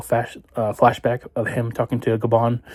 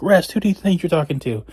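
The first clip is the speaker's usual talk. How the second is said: indignant scoff; 7 semitones higher than usual. Retold or imitated. Luffy scoffs at being told rest.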